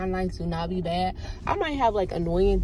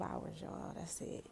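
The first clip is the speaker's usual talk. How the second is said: whisper; 20 dB quieter, slower than usual. Real, not acted. Whispered remark.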